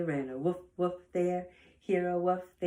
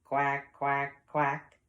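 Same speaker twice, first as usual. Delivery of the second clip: three low, firm quacks; duck quacks; 4 semitones lower, 4 dB louder, much slower than usual. Acted. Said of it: "And a duck says".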